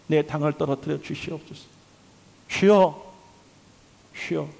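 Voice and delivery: low, soft petitions with pauses; hushed prayer. Real, not acted. Preacher praying for healing of the sick, hushed pleading. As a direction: pray quietly for healing, line by line.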